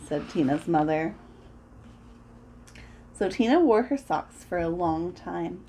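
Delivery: ordinary delivery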